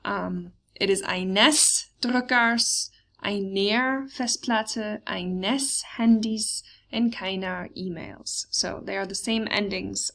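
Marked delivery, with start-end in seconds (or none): 1.0-8.5 slow, careful listing of forms; slow model reading. Shown as a demonstration. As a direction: read each form slowly, word by word. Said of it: "very similar to what we just saw".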